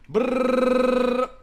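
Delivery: loud rolling brrr of hype. Real, not acted. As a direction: trill it out high and loud. Hype noise while going live.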